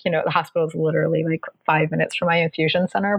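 usual speaking voice

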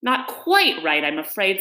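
ordinary delivery, nothing marked

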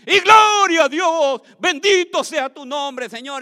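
high, slow, shouted worship; full-force preacher's hallelujah; fervent praise. Real, not acted. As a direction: cry praise out with long vowels. Breaks into loud praise.

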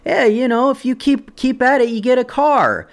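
high mock of a dad luring his son. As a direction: dangle the promise in a sugary mock voice.

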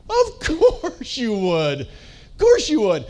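slow, drawn-out mock certainty; playful, knowing drawl; of course, obviously. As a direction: stretch it out like it's too obvious to ask.

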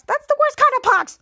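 high, loud exclamation; acted outrage. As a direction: cry it out in mock horror. Acted outburst over a disease.

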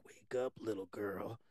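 low-key mock creepy villain voice; put-on sinister voice; Freddy. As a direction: imitate a creepy monster waking a child. Freddy waking little sister.

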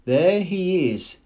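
spring the trap with relish; triumphant reveal. Acted. Bernard reveals the director.

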